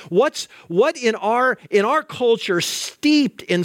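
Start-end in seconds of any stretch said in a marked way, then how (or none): none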